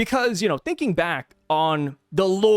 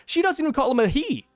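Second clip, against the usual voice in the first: ordinary talk, nothing marked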